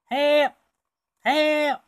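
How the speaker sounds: high, loud cries of help from across the way; mock distant cry for help; calling for help. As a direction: yell it out to anyone nearby.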